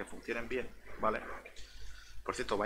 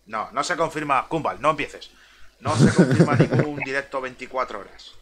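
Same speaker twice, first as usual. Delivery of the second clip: loud denial; 11 dB louder than usual. Real, not acted. Denies rumour, scolds chat.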